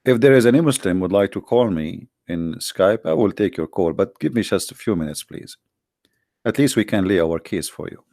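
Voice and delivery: ordinary talk, nothing marked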